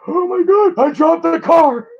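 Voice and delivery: high-pitched mock shock, slow on the last words; mock panic. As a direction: squeal it like a heart attack. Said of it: "I'm gonna be like".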